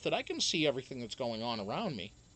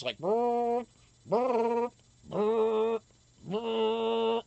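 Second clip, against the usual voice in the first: buzzing lips like a dentist drill; drill noise; 5 semitones higher, 3 dB louder, much slower than usual. Retold or imitated. Mimicking the dentist's drill on his teeth, buzzing. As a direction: brr like a drill whirring.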